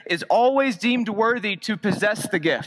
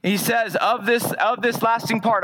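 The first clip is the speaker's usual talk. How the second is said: normal talking voice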